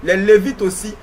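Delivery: ordinary delivery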